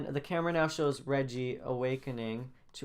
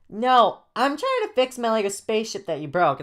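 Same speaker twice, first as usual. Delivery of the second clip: put-on high, loud childish protest; seven-year-old kid; 9 semitones higher, 9 dB louder than usual. Jaden refusing Joey over a broken spaceship, childish voice. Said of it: "Jane talks like a seven-year-old".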